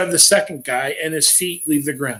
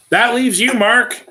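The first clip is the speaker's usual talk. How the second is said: dreigend geroepen; 7 semitones higher, 5 dB louder, slower than usual. Acted. Narrator confronts Mark threateningly.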